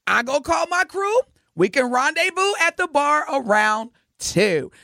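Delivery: high-pitched, deliberate model questions; coaching pickup lines. Acted. Host quoting [name]'s blueprint to the men, high and deliberate. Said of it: "Biggie told y'all thirty years ago".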